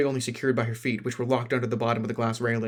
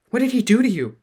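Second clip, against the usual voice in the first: alarmed shout; 11 semitones higher, 8 dB louder than usual. Acted.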